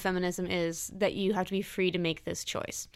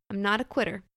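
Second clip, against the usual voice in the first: usual speaking voice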